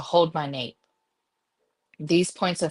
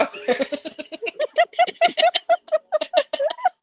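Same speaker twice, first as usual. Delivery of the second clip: shrieking laugh, much higher; high-pitched burst of laughter; 4 dB louder than usual. Real, not acted. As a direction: squeal with laughter.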